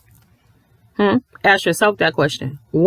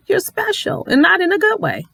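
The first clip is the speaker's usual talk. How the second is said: high sarcastic singsong; high syrupy sarcasm; mock sweet; 11 semitones higher than usual. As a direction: say it like a backhanded compliment. Mocking high "special.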